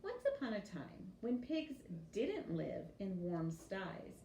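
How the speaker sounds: ordinary delivery, nothing marked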